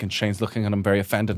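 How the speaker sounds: normal talking voice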